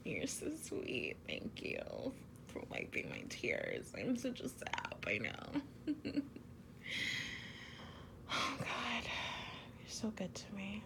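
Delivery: soft intimate whisper close to the ear; ASMR whisper. Acted. Girlfriend confessing love to her partner, whispered. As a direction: breathe each word like a secret to a lover. Thanks partner for wiping tears.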